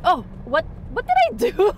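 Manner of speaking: high, squeaky mock alarm; flustered panic. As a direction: act out sudden confused panic. Retelling panic at locked pump.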